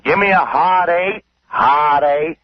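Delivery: retold screaming. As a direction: imitate a drunk gambler yelling for his number. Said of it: "He was screaming".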